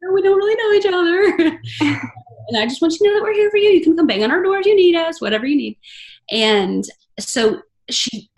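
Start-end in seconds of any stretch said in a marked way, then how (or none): 0.0-5.8 eager consoling neighbour. Retold or imitated. Voicing her own consoling words.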